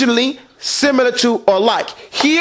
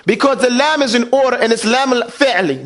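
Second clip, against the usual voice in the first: normal talking voice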